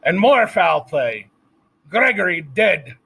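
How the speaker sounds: loud, high, slow cry of alarm; shouting. A narrator's reading. Scene: Cook shouting to Louise about Gregory, loud. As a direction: shout out the bad news. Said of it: "shouted Cook".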